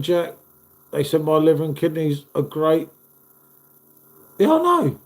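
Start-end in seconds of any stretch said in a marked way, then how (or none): none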